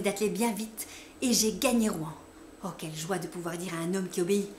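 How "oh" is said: usual speaking voice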